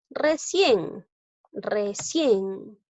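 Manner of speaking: "Recién" sounded out twice, CIÉN stretched; teacher showing the stressed ending of "Recién". Shown as a demonstration. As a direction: lean on the last syllable of "Recién". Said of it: "Sílaba tónica en cien".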